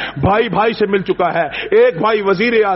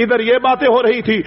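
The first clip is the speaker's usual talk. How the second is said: ordinary delivery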